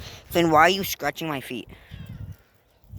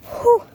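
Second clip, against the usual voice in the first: high whew; 11 dB louder than usual. Real, not acted. Exclaiming in high yelp.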